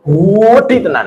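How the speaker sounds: slow stretched "Gede tenan" in Surabaya style; drawn-out Surabaya exaggeration. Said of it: "orang Surabayaan". (logat Surabaya, "Gede tenan" dipanjangkan)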